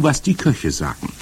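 usual speaking voice

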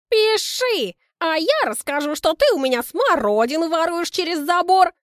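high, loud, furious neighbour; shrill angry retort. Acted. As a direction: snap back at the neighbour. Quarrel, Kristina retorts.

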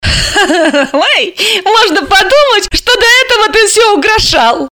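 high-pitched teasing laugh into words; laugh, then tease him in a high voice; laughing retort. A narrator's reading. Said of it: "рассмеялась Алиса".

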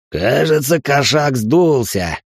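slow, snide taunt. Acted. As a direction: sneer it out slowly. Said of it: "ехидно обронил хмырь".

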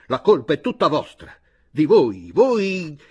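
loud angry shout, rising on the last word; shouting accusation. A narrator's reading. Chojnicki blaming the company, fist on the table, shouting. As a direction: shout the blame, groping for the word. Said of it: "picchiò il pugno sul tavolo gridando".

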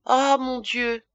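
softly sighed lament; sad interjection. A narrator's reading. Good lady interrupts with bad news, sadly. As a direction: exclaim with gentle sorrow. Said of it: "Interrompit d'un air triste la bonne dame".

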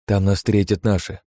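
usual speaking voice

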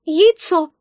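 high, proud little creature. A narrator's reading. Egg is asked its name, proud answer. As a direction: declare it proudly in a squeaky voice. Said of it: "прозвучал гордый ответ".

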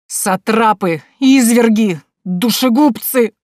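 loud, high cries of outrage; shouting. Acted. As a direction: yell it from upstairs. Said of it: "крики дядюшки".

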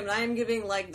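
usual speaking voice

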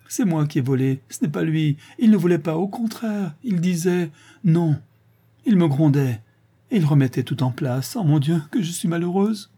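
high, pleading, near tears; the girl confesses to her mother, high and tearful; tearful confession. A narrator's reading. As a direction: blurt it out in distress. Polly confesses sobbing to mother.

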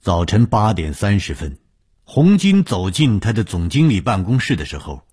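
ordinary delivery

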